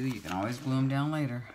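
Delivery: ordinary delivery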